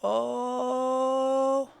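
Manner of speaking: long, high held wail, imitated; hammed-up old woman receiving a message; entranced old lady. Gym lady hears from God, high drawn-out trance voice. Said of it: "She goes".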